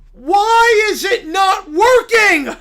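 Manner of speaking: loud, anguished preacher's cry; a wounded zealot shouting at the sky; outraged preacher. Acted. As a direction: howl it like your church just fell. Character frustration.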